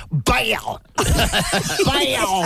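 high, laughing "Bail" at the dog; laughing scold. Retold or imitated. Owner laughing while scolding the dog, high. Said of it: "Then I say".

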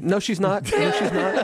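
high, laughing denial; amused, high-pitched objection; laughing protest. Real, not acted. Laughing denial of teasing jab.

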